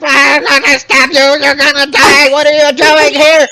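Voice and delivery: high, loud kobold yelling; angry kobold shouts. Acted. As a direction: shriek at the intruders like frantic kobolds. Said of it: "they're like".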